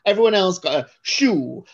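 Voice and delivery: ordinary talk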